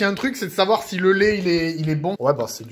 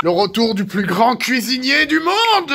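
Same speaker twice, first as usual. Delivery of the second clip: slow, higher, louder show-host fanfare; grand announcement; 5 semitones higher, 4 dB louder, slower than usual. Acted. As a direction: announce yourself like a star chef arriving. Grand self-announcing show opening.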